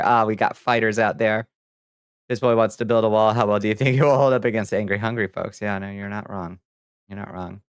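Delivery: ordinary talk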